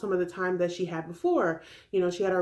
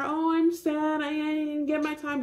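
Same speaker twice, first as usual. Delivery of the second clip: higher, lightly whiny mockery; mock whiny Courtney; 6 semitones higher than usual. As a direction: mimic her moping, half-hearted. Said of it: "Demi is not trying to hear".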